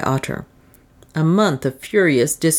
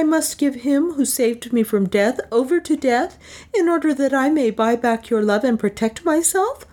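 high-pitched, indignant refusal; 9 semitones higher than usual. A narrator's reading. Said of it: "her indignation was too great".

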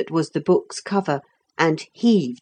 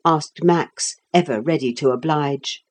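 plain reading voice

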